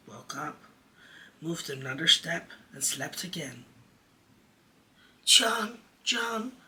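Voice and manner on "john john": high, loud calls of a name; crying out. A narrator's reading. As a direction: call out to wake his brother. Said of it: "Michael would cry".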